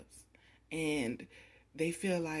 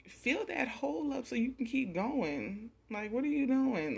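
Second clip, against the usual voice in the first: higher, pushed-up frustrated plea; exasperated; 8 semitones higher, 4 dB louder than usual. Retold or imitated. Reader voicing the spirits' frustration at the querent, higher exasperated. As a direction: urge them on, half scolding. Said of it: "that's what they're thinking".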